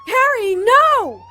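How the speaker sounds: very loud, high, drawn-out cry; desperate shout. Acted. Trying to stop Harry running into the barrier, desperate scream.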